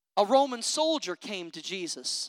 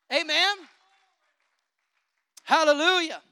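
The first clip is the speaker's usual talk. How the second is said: ordinary talk, nothing marked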